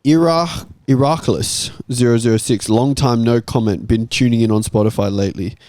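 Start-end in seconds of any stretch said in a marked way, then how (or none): none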